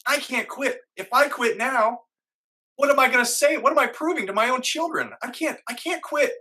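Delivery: high, urgent inner voice; determined self-talk. Retold or imitated. Pep talk to himself mid-race.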